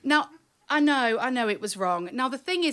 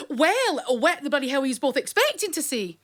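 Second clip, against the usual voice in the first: imitating an exasperated mother, loud and higher; scolding mum; 4 semitones higher, 5 dB louder than usual. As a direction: bawl out two children as their fed-up mum. Mum scolds screaming children, acted.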